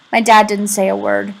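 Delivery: ordinary delivery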